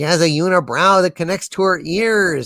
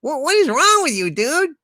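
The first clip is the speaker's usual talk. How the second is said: higher, hammed-up shout of disgust; mock shout; 7 semitones higher than usual. A narrator's reading. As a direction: yell at the friend in shock. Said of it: "you shout at him".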